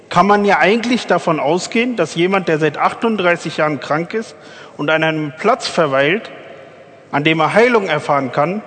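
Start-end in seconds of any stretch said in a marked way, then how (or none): none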